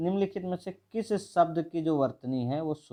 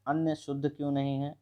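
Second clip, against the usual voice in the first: ordinary talk, nothing marked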